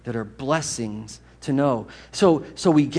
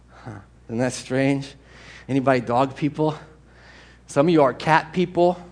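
ordinary delivery

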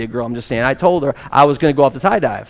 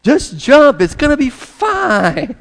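higher, teasing crowd call; urging; 11 semitones higher, 6 dB louder, slower than usual. Retold or imitated. Crowd below coaxing the boy, high teasing call. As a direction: coax him off the edge.

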